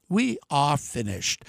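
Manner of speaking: sinking low and soft, slow and heavy; grim drop. Real, not acted. Warning voters, voice drops low, soft and slow. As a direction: let it fall away, dead quiet and slow.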